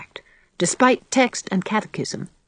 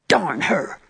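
exploding; 2 semitones lower than usual. A narrator's reading. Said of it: "exploded Davy".

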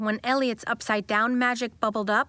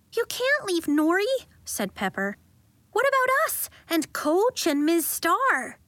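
upset protest; 9 semitones higher than usual. A narrator's reading. Pepper protesting.

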